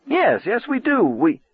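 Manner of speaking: desperate. A narrator's reading. Arnie pleading with Lee not to hang up, desperate. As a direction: scramble to keep her on the line.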